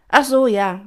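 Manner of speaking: loud, high, quick backdown; hasty reassurance. A narrator's reading. Pina hastens to calm Doña Rosa, high and loud. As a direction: hurry to placate her. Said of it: "beeilte sich Pina, Doña Rosa zu beruhigen".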